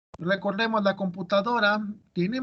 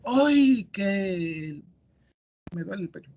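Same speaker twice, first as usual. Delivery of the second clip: exclamation demo; slower than usual. Shown as a demonstration. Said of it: "puede ser exclamativo".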